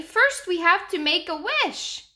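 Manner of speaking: put-on high, loud little boy voice; child voice. Danny explains.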